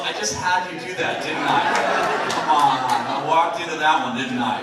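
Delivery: loud, laughing admission; laughing at himself. Real, not acted. Speaker laughing at the crowd over his own trick. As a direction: laugh through it, caught out.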